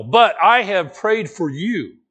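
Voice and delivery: slow, weighty, each word set down deliberately; a slow, firm promise; emphatic and solemn. Acted. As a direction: lean hard on But and let it land. Jesus' words, emphasized as the contrast.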